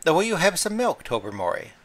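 Lady Blemley offering milk to the talking cat, strained and high. A narrator's reading. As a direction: force a polite tone through nerves. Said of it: "in a rather strained voice".

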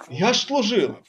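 ordinary talk, nothing marked

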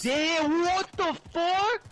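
shocked high shout, stretched out; surprised yell. Real, not acted. Sudden ambush in game.

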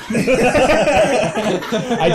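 slow, laughing confession. Real, not acted. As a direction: laugh through the admission. Laughing self-mockery.

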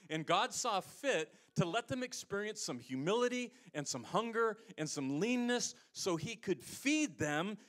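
fervent sermon climax; preaching. Acted. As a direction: drive it home from the pulpit. Preaching builds to full force.

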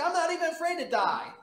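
high, loud boastful imitation of his younger self; mock bravado. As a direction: brag like a cocky young man. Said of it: "That's what I would say".

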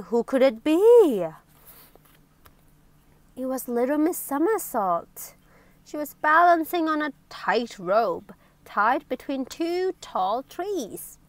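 plain reading voice

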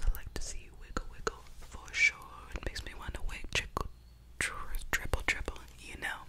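normal talking voice

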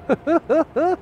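short high laugh; quick high-pitched giggle. Real, not acted. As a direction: burst into a giggle. Laughs at reflection.

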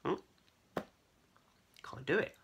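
ordinary delivery

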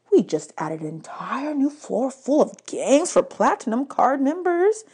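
slow, tempting salesman; a hint of a sly hotel clerk; lightly voiced oily bellhop. A narrator's reading.

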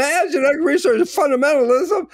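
high, loud mock proclamation; retold outburst. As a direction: call it out over a roomful of people. Retelling his provocation of angry audience.

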